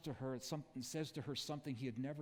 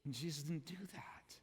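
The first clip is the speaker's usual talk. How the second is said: whispered; 5 dB quieter than usual. Real, not acted. Emphatic aside.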